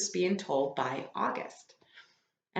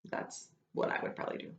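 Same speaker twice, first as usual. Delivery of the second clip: quick, much softer whisper; whispered aside; 9 dB quieter, faster than usual. Real, not acted. Teacher whispering a joke aside mid-story. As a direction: toss it off under your breath.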